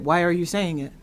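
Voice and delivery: ordinary talk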